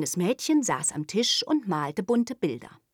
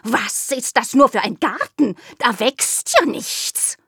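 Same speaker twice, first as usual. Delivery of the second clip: hissing cat; 6 semitones higher, 6 dB louder than usual. Acted. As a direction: scoff like a cross cat. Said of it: "und fauchte".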